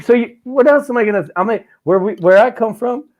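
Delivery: squeaky mock outrage at the glass door; outraged disbelief.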